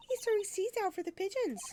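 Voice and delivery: put-on excited young Maya urging Nick on; Maya's voice. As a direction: pitch way up as the eager sidekick. Voicing Maya.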